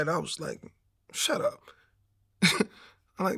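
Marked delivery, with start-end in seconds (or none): 1.1-1.6 very high, quiet squeaked retort; mock exasperation. Patient cuts off his preaching father-in-law, mock exasperation. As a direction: squeak it out in comic disbelief.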